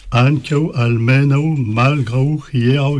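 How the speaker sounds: "ankau, almenau, malgrau, hierau" each stressed just before the au glide; correct tonic accent. Shown as a demonstration. Teacher listing words for the trick, correct tonic accent. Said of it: "de placer l'accent tonique".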